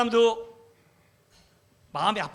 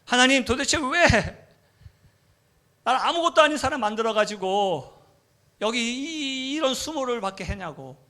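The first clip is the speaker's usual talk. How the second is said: resentful cry to God; 5 semitones higher than usual. Retold or imitated. Man on a bike blaming God, resentful cry. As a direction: hurl the complaint upward. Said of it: "하나님을 원망하기 시작한 거죠".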